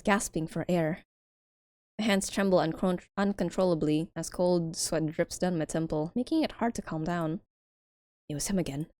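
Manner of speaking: soft, quiet reading; story narration. Acted. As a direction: read it hushed and tense. Reads game text softly.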